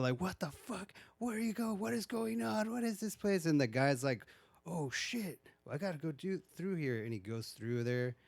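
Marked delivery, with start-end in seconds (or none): 0.2-3.5 freaking out. Retold or imitated. Girl freaking out inside a strange place, high mock panic. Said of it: "the girl starts freaking out". 4.7-7.0 awed surprise. Retold or imitated. Guy amazed at the strange place.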